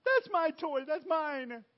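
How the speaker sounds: put-on child fighting over toy. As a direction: claim the toy like a bratty child.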